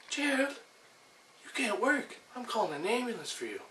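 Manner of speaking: whiny high-pitched mimic of a worried buddy; mocking Gary's voice. Mocking Gary warning him he can't work, high whiny voice. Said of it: "And he says".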